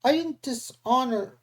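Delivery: snapped high and sharp. A narrator's reading. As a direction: cut her off defensively. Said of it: "Luke snapped".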